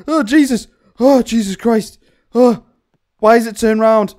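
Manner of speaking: loud, startled exclaiming; shaken alarm. Real, not acted. Player seeing the doll turned round, alarmed. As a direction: blurt it out after a scare. Recovering from jump scare.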